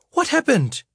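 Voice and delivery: mother seeing her son covered in juice, alarmed; horrified mother. Acted. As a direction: gasp it out at the sight of your child. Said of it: "My mother looked at me horrified".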